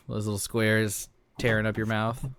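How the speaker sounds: usual speaking voice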